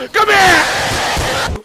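loud high shout at the monster. Real, not acted. Calling out at the chasing girl, loud shout. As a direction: yell it out, startled.

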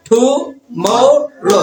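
very slow "tomorrow", each syllable apart; teacher's slow syllable demo. Shown as a demonstration. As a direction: stretch "tomorrow" into three separate beats. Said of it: "কয়টা syllable?".

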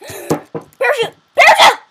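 high loud panicked call; urgent shout. Real, not acted. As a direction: yell it in alarm. Urgent bailout call in game.

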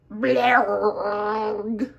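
a loud wet spit-out blerg; spitting noise. Acted. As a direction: spit it out like a disgusted penguin. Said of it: "Et le pingouin recracha".

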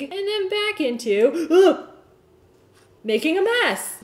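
high, loud and flustered; squeamish fluster. Real, not acted. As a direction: voice climbs as the mess happens. Messy dunking while narrating.

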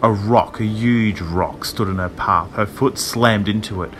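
ordinary delivery, nothing marked

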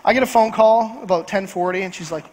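ordinary talk

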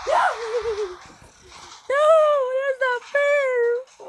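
very high, slow, crying wail; real tearful, drawn-out complaint; crying protest. Real, not acted. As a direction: sob it out as if cheated. Kid losing, near tears.

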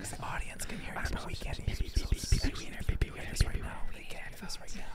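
teasing ASMR-style whisper; giggly prank whisper. Pretend. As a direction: whisper close to the mic, teasing. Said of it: "It's A S M R".

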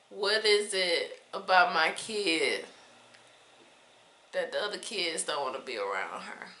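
quiet, saddened wondering; sadly puzzled, trailing into softness. Real, not acted. Mother wondering why kids avoid her daughter, sad. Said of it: "It makes me sad".

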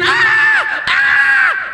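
very high, loud roller-coaster scream; re-enacted scream. Rider plunging straight down on a coaster, screaming. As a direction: scream as the drop hits. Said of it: "I'm screaming".

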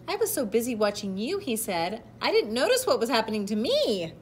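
animated read-aloud with deliberate emphasis; picture-book narration. A narrator's reading. Reader telling children of a proud caterpillar, storybook narration. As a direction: read to children, projecting key words.

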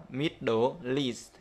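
slow "mid-do-least", the l opening EAST; "Middle East" linked and drawn out; linked sounds. Shown as a demonstration. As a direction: join MIDDLE onto EAST through the l. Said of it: "nối vào luôn".

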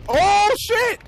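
very high, loud cry of alarm; big high-pitched startled curse; startled yell. Real, not acted. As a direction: shout out in sudden alarm. Sudden danger in the game.

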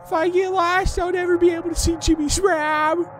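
very high, loud, drawn-out cartoon whine; Carl Wheezer. Gamer playing Carl lost in a cave, cartoon impression. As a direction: put on a squeaky, slow, fretful kid voice. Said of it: "I'm gonna go to Carl Weezer impressions".

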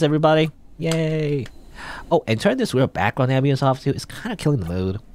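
theatrical, breezy game character, dropping the solemnity; casual cheeky spirit. Acted. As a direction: play a self-satisfied showman addressing a crowd.

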